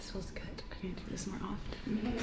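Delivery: very soft relaxed murmur; barely voiced contentment; hushed. Real, not acted.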